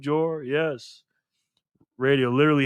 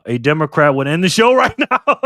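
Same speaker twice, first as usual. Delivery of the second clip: loud, higher-pitched line breaking into real laughter; laughing; 5 semitones higher, 10 dB louder than usual. Real, not acted. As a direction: crack up loudly as you say it. Jokes with chat, bursts out laughing.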